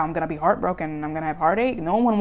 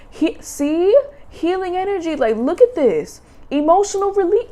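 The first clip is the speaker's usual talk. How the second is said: much higher, thrilled card names; excited; 10 semitones higher, 6 dB louder than usual. Real, not acted. Reader thrilled showing viewers the healing cards. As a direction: lift each name up in delight.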